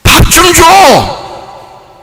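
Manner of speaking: very slow, high yelled demand; hungry child's long cry; drawn-out shout. Acted. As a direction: stretch the shout out like a spoiled kid.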